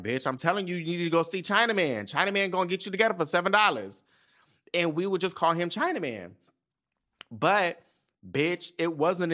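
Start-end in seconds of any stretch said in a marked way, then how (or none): 0.0-4.0 hyped-up recommendation. Retold or imitated. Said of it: "I'm like".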